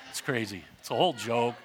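usual speaking voice

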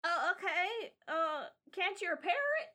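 lightly voiced kid asking a favor; hopeful kid. Kid asks mister to repair it, hopeful child voice.

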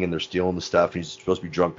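ordinary talk

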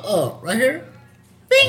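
high, light little question and a button blip; mock innocent. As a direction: act out how easy the click is. Acting out easy upload.

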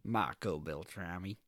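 mild American accent, low and unhurried; understated American take on an Italian name. Shown as a demonstration. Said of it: "Or in America".

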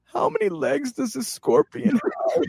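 high, slow, pained whimper imitating Jack. Friend mid-tattoo asks Eric anxiously, high pained whimper. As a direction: squeak it out like a kid in pain. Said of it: "And he's like".

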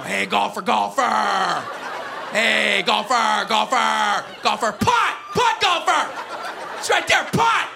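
loud, rising mock chatter at a golfer; mock golf heckling. Fan heckling a golfer on the course, loud mock chant. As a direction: heckle the golfer like a Little Leaguer.

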